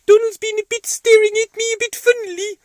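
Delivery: very high, loud squeaky dog voice; a silly little dog answering back; high shrieky cartoon pup; daft dog puppet.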